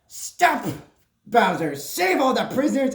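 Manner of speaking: normal talking voice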